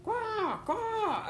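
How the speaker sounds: very high cawing cry; crow caw. A narrator's reading. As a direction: imitate a crow with two high falling caws. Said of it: "A crow was near".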